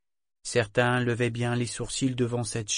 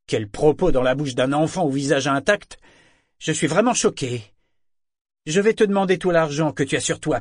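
loud, higher, sarcastic menace; mocking threat; 5 semitones higher, 7 dB louder than usual. A narrator's reading. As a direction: feign shock then demand. Broker mocks, demands money.

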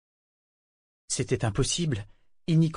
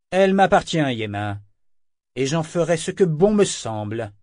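higher, louder, claiming ownership; possessive anger; 5 semitones higher, 5 dB louder than usual. Acted. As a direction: bark it as a master's claim. Kénit claims Althea as property. (Kénit à Yémin, colère possessive et forte)

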